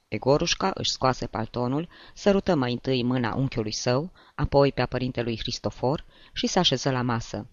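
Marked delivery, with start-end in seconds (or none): none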